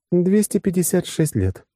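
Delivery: high-pitched female character voice; woman's factual answer. Acted. Woman telling the man how long it has been, high voice. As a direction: state the figure high and evenly.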